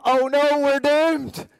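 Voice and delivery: high, loud comic dread; hammed-up alarm at his own failings; mock panic. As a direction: yelp it out in mock despair. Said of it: "I go".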